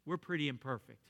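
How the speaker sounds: usual speaking voice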